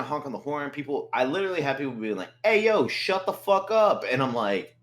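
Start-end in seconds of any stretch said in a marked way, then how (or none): 2.4-4.0 high, slow angry yell out a window; a mock neighbor yelling, high and slow. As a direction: yell at him to shut up.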